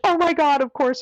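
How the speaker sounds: high-pitched imitation of a gushing helper; eager exclamation. Woman agreeing to untie the runner's bag, eager exclamation.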